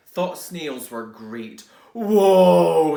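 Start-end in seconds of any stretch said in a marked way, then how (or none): 1.9-3.0 loud drawn-out amazed whoa; big wonderstruck whoa. Acted. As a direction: gasp it out in wonder. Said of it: "said Norman".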